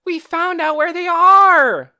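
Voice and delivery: loud excited yell. A narrator's reading. Boy yelling his discovery to the housekeeper. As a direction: shout it out breathless with news. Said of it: "yelled Torin".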